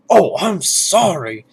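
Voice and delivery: mock American accent, overdone on purpose; bad American accent. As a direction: mimic a Brit doing a clumsy American accent. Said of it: "try to do American accents".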